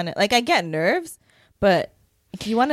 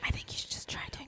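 whispering; 18 dB quieter than usual. Pretend. Mock ASMR whisper.

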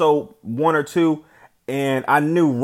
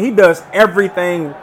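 ordinary talk

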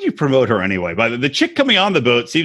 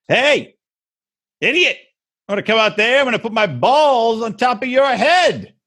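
high, teasing taunt called out; trash talk; 8 semitones higher than usual. Retold or imitated. Player taunting the pitcher at kickball, mock trash talk. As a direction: heckle the pitcher in a hammed-up high voice. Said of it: "trash talk shit".

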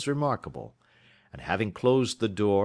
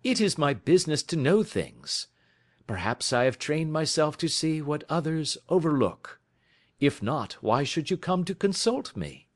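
a lightly voiced Holmes, higher and assured; 8 semitones higher than usual. A narrator's reading. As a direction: read Holmes as the cool, knowing detective.

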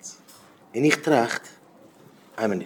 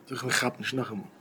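ordinary talk, nothing marked

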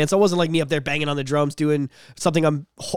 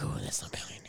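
whisper; 19 dB quieter, faster than usual. Retold or imitated. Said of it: "I was like".

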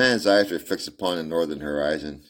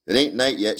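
put-on little girl; 6 semitones higher, 6 dB louder than usual. Little girl voiced in story.

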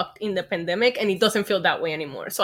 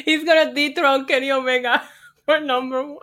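laughing; 5 semitones higher, 5 dB louder than usual. Real, not acted. Joins the joke, laughing.

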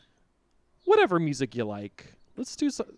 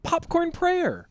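announcer voice; 8 semitones higher, 4 dB louder than usual. Acted. Announces next show segment.

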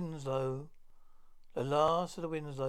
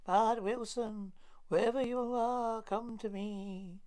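ghost voice; 6 semitones higher than usual. Acted. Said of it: "I think I heard ghost voice".

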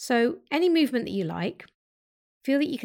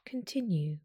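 guided meditation. Acted. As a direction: talk them through each breath gently.

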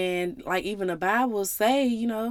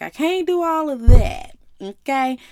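God's voice; 4 semitones higher, 9 dB louder, slower than usual. Retold or imitated. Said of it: "But he was like".

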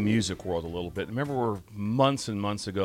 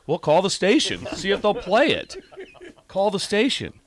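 excited; 8 semitones higher, 3 dB louder than usual. Retold or imitated. Excited retelling, high and loud.

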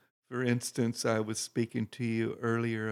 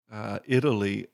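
ordinary delivery

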